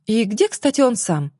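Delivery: usual speaking voice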